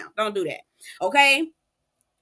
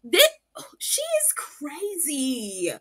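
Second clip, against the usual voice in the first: slow aside; 3 semitones higher, 3 dB louder, much slower than usual. Retold or imitated. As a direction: drag it out, unimpressed. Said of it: "I was like".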